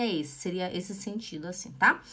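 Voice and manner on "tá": ordinary talk, nothing marked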